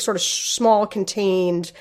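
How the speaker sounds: normal talking voice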